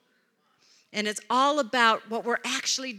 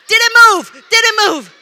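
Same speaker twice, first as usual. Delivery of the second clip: high, full-volume frantic shout; imitated yelling; 8 semitones higher, 13 dB louder than usual. Pastor wrestling vacuum still asks about spider, frantic mock shout. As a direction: belt it out in mock panic. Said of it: "he's still yelling".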